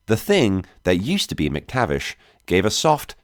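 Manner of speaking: plain reading voice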